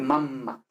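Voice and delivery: "mamma" with held double m; long m. Shown as a demonstration. Teacher repeats long m in mamma. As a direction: lengthen the m in "mamma". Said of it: "Con esta M larga".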